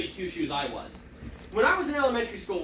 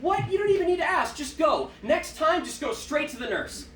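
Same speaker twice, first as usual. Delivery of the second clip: lightly voiced impatient teacher; exasperated teacher; 7 semitones higher than usual. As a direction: wave the kid off, baffled. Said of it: "she's like".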